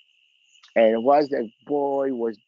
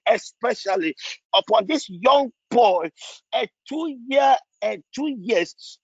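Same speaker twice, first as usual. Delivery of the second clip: impassioned pleading prayer, pitched high and rising; fervent prayer; 5 semitones higher than usual. Acted. Pastor praying for a sick boy's family, impassioned pleading. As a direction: cry out to God for mercy, building in intensity.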